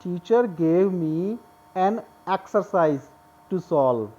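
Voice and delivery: "Teacher gave me an exercise to solve" read very slowly, word by word; slow classroom reading; slow dictation. Shown as a demonstration. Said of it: "next sentence देखिए ज़रा".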